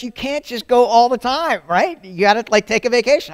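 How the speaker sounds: loud, high, insistent wife voice; wife's nagging. Retold or imitated. Wife urging her husband to take a vacation, loud and high. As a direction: push him to take a break, loud and high. Wife Amy scolds him.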